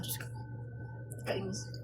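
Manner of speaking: whispered, fed up; annoyed whisper. Real, not acted. Helper venting about her lazy coworker, whispered in annoyance. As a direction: mutter it under your breath in annoyance.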